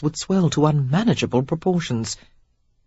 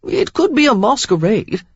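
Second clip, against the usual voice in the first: reasonable; 7 semitones higher, 4 dB louder than usual. A narrator's reading. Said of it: "pointed out reasonably".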